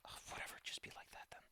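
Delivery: whispered, fast and annoyed; frustrated whisper. Real, not acted. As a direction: mutter it under your breath, exasperated. GM frustrated with software.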